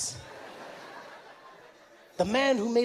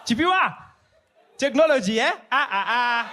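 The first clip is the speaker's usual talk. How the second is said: mock amazement; 4 semitones higher, 5 dB louder than usual. Mocking amazed mall visitor.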